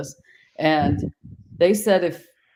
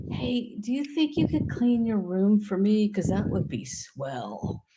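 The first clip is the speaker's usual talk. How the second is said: a sweet, higher, pleading request; timid asking parent; 4 semitones higher, 3 dB quieter than usual. Shown as a demonstration. Parent asking a kid to clean the room, sweet and pleading. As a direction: ask the kid nicely, almost begging. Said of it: "do you say".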